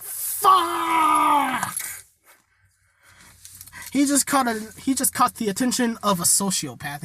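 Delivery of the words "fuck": loud, drawn-out curse; drawn-out shout of dismay; frustrated shout. Retold or imitated. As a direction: shout it in despair. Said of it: "Bakugo's like".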